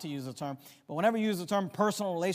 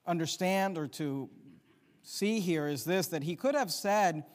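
normal talking voice

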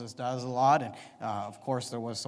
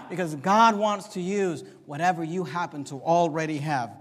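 ordinary talk

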